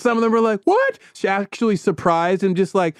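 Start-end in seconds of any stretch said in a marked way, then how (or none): 0.7-1.0 high, stunned squeak of disbelief; a bartender betrayed, gasping a sharp high what; shocked what. Retold or imitated. Bartender learning he isn't [name], shocked high what.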